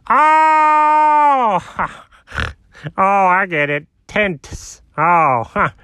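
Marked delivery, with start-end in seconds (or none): 0.1-1.6 high, drawn-out "oh" of sudden understanding; long realizing "oh". Acted. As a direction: let a long high "oh" ring as it dawns on you. Finally gets the joke's pun.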